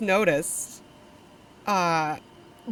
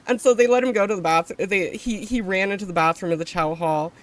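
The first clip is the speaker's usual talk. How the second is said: ordinary talk, nothing marked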